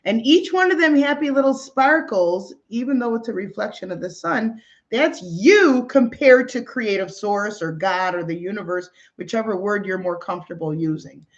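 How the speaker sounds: usual speaking voice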